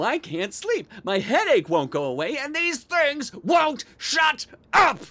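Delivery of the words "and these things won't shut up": slow, high, loud outburst; exasperated shout. Acted. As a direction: shout each word in sheer frustration. Exasperated rant about the noise.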